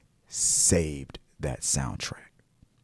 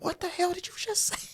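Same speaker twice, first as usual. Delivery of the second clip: mock outrage; 7 dB quieter than usual. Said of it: "I was like".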